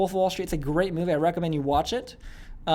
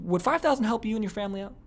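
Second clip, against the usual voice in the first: soft, higher-pitched caring question; extreme I-care tone; 5 semitones higher, 4 dB quieter than usual. Shown as a demonstration. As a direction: ask softly with tender concern. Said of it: "that's a very extreme version of I care".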